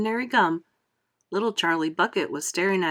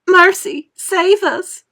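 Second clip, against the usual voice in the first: high, loud panicked cry; yelled; 12 semitones higher, 11 dB louder, much slower than usual. A narrator's reading. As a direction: cry out to heaven in terror. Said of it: "yelled Missus Beauregard".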